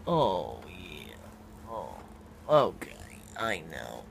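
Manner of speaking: low, soft reassurance; calm the animal down gently; soft low murmur to a nervous pet; soothing. Real, not acted.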